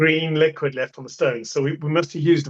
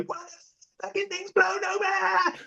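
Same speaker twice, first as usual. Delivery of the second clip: much higher, softer, slowed panicked disbelief; panicked call; 6 dB quieter, slower than usual. Retold or imitated. Crew rings the contractor about the toppled tanker, high panicked squeal. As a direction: squeak it out in sheer panic down the phone. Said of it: "and we go".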